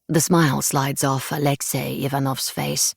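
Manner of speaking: ordinary delivery, nothing marked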